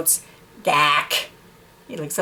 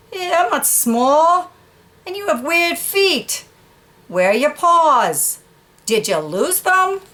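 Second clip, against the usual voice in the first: higher, slow and teasing; big dog voice; 9 semitones higher, slower than usual. Acted. Dog teasing the horse about his feet, high and slow. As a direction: poke fun at his feet.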